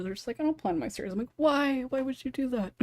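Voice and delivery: ordinary talk, nothing marked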